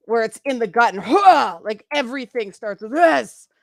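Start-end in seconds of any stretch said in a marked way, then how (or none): 1.0-1.6 loud, forceful "huh" from the gut; tough-chick grunt. Shown as a demonstration. Demonstrating angry tough chick voice, loud gut grunt. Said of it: "angry tough chick".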